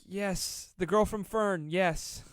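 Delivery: high, loud burst of yeses; mock eager confession. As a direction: blurt it out loudly as if caving at once.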